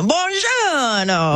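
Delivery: slow, hammed-up Italian accent; a playful Italian greeting in an Italian accent. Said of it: "at, uh, Alitalia or something".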